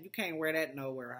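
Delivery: usual speaking voice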